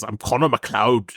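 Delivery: a hammed-up attempt at a Scottish accent; bad Scottish accent. Podcaster mocking an actor's code switch, bad Scottish accent. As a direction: put on a clumsy Scottish accent. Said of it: "saying he's Scottish".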